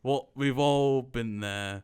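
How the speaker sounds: loud, drawn-out imitation of the old guy; imitated old guy. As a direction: stretch it out slowly, hammed-up. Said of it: "He's like".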